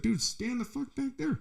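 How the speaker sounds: high, exasperated mock demand; retold warning. To a man on his shoulder in line, high retold warning.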